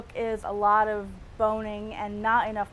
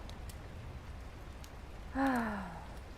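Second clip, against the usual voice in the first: swooning sigh; 9 dB quieter than usual. Acted. After reading Fabio letter.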